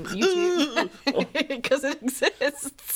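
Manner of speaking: giggling through the words, slow; laughing. Real, not acted. Host giggling at her own gross joke, laughing.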